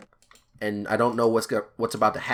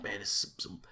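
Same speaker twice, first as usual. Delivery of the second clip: softer, muttered under the breath; mumbling; 3 semitones lower, 14 dB quieter, faster than usual. Retold or imitated. Angry driver mumbling in the van, soft mutter. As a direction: mumble it to yourself. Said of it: "He starts mumbling".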